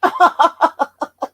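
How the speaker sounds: sudden, loud burst of laughter. Real, not acted. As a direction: break into a big laugh. Laughing after teasing chat.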